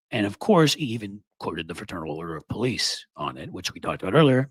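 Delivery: ordinary talk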